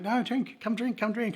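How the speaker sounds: very high imitation of coaxing locals; insistent local drinkers. Drinkers press the departing visitor to join, high coaxing voice. Voices the group urging him.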